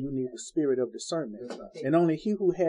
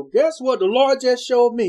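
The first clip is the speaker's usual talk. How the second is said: mocking a boastful brag, higher and louder; eager boast, pitched up and pushed; showing off; 8 semitones higher, 9 dB louder than usual. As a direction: play the show-off who can't keep a secret. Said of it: "make a big show".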